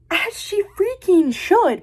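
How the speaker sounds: high, drawn-out exclamation; indignant emphasis. Real, not acted. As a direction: stretch it out in fired-up agreement. Indignant agreement with character's anger.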